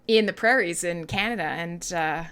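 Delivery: ordinary delivery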